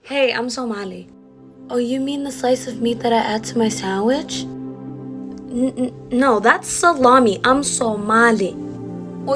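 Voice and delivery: ordinary talk, nothing marked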